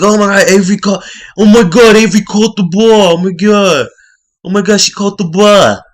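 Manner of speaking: high, loud, fast excited squeal; mock giddy squealing; excited shriek. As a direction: gush like you can't believe she caught it. Johnny excited she caught ball.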